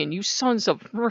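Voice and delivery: usual speaking voice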